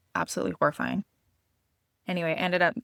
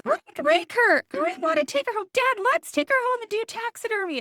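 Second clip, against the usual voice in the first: put-on very high, eager kid voice pleading; a thrilled child begging to keep the bunny; excited little boy; 5 dB louder than usual. A little son begs his dad to take the bunny home, in a very high excited kid voice. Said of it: "and he's".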